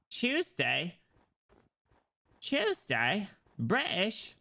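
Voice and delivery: high, hammed-up British accent. Streamer riffing on British words, high exaggerated British accent. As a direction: squeak out caricature British words. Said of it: "doing British accents".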